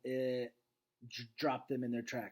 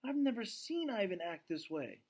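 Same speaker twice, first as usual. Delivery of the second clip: put-on higher, worried young girl; a lightly voiced anxious daughter; 7 semitones higher than usual. Julia tells her dad, in a higher worried voice.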